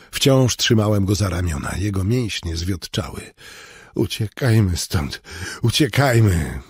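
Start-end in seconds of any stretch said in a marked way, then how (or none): none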